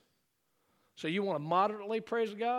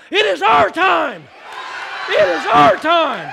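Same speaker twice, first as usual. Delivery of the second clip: loud, slow, triumphant shout; preacher rousing the church to shout; 9 semitones higher, 16 dB louder, slower than usual. Real, not acted. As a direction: shout it out like a victory cry. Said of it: "somebody needs to shout right now".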